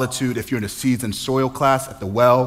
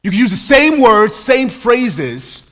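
higher and louder, rhythmic and near-sung; fervent preaching; 9 semitones higher, 8 dB louder than usual. Acted. Pastor warns the congregation in a rising full-force sermon. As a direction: drive each phrase home from the pulpit.

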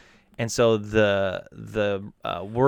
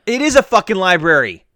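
loud, high exasperated retort; indignant insistence; 9 semitones higher, 11 dB louder than usual. Real, not acted. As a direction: insist hotly that you are right. Heated argument over a translation.

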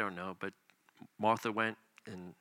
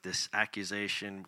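ordinary talk, nothing marked

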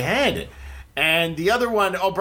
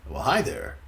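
lower, suave pickup voice; mock smooth seducer; 4 semitones lower than usual. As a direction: woo a lady, hammed up. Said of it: "what a way to swoon a lady".

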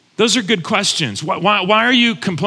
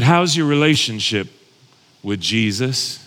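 imitated Southern accent; 5 semitones lower than usual. Counselor questions the pastor about his faith, mild Southern accent. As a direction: ask it with a light Southern touch, dropping away. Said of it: "had this deep Mississippi Southern accent".

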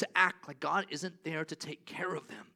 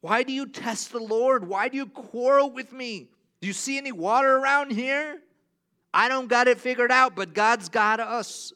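loud, high, urgent questions; Moses confronting; 9 semitones higher, 13 dB louder than usual. Retold or imitated. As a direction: Moses challenging the crowd, louder and higher. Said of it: "And so he asks them".

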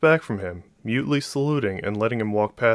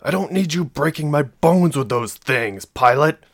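raised, irritated snap; snapped; 6 semitones higher, 5 dB louder than usual. A narrator's reading. Thomas to pilot who stepped on his foot, snapping. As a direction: scold sharply, pitched up and loud. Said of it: "Thomas snapped".

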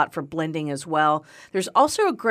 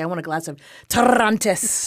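put-on Spanish accent. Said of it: "I wanna sit down and say".

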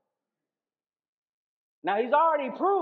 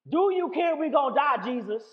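1 desperate prayer; 6 semitones higher, 6 dB louder than usual. Retold or imitated. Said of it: "this prayer is that it is not pretty".

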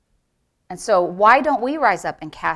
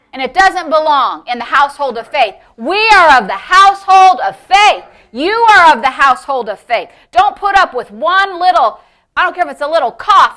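loud, raised, emphatic sermon delivery; full-force preaching; 6 semitones higher, 8 dB louder than usual. Real, not acted. Preacher urges believers to refuse sickness, fervently loud. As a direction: drive each phrase home to the congregation.

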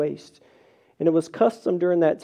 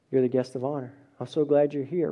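soft welcome; 6 semitones lower, 6 dB quieter, faster than usual. Retold or imitated.

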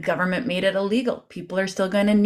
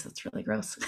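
whispered aside; 3 semitones higher, 12 dB quieter than usual. Real, not acted. Aside about the dog noise.

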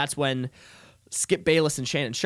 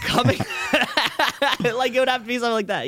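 words squeezed out through real laughter, pitched up high and fast; laughing while talking; 9 semitones higher, much faster than usual. Real, not acted. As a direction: giggle through the line as it bursts out. Laughing about Adam Cole.

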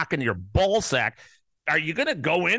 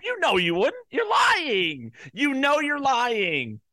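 raised, high-pitched angry shout; angry accusation; 8 semitones higher, slower than usual. Real, not acted. Host calls out election deniers who won't give it up, angry accusation. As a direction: call out the liars, high and drawn out at the end. Heated rant accusing listeners of lying.

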